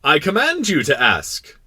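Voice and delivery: loud, slow, high royal order; commanding king. Acted. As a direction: turn permission into a pompous command. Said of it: "the king hastened to say".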